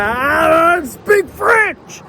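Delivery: drawn-out, high, loud wail; panicked mock yell. Overwhelmed customer at the gas station, in a mock panicked yell. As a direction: blurt it out in a hammed-up, stretched scream.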